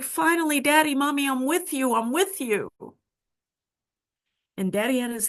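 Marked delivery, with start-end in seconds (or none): 0.0-2.7 excited. Retold or imitated. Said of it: "And I'm all excited".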